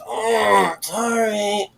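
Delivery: high, soft, worn-out apology; a feeble prisoner mumbling sorry; weak prisoner voice. Acted. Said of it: "And then Thatcher goes".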